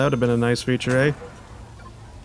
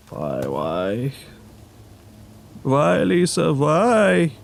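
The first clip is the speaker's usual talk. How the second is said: terrible Tommy Wiseau; much slower than usual. Acted. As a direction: do a bad overwrought movie impression. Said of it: "That was a terrible Tommy Wiseau impression".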